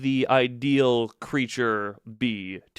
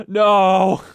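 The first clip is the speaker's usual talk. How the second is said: shout; 6 semitones higher, 10 dB louder than usual. Real, not acted. Pleading the robot not to die.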